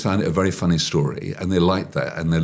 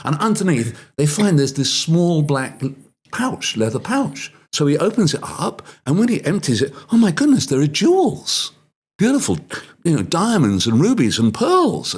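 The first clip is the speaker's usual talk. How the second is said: storytelling wonder; 8 semitones higher than usual. Acted.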